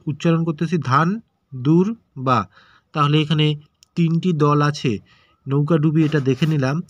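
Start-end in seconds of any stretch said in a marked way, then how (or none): 0.8-2.4 ধান দুর্বা in three separate syllables with gaps; broken into syllables. Shown as a demonstration. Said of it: "এটা ভেঙে ভেঙে".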